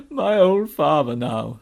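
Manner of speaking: slow, loud, woeful faun voice; lamenting faun. Acted. Faun recalling his father to Lucy, lamenting. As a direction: draw it out mournfully.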